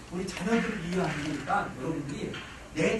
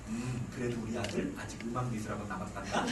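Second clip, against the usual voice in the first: 낮고 조용한 냉정한 엄마 목소리; 감정 없이 차갑게 낮춰 말하듯; cold rational mom; 10 semitones lower, 6 dB quieter than usual. Retold or imitated. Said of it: "이런 엄마들은". (냉철한 엄마가 지친 아이에게 낮고 차분하게)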